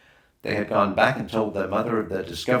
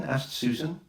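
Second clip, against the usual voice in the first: ordinary delivery, nothing marked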